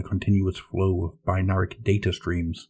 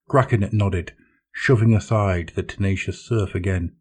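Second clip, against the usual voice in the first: plain reading voice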